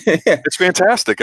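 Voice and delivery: high, slow, gushing praise; real delight. Real, not acted. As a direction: savor how great it is. Delighted reaction to the scene.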